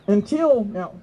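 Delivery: ordinary talk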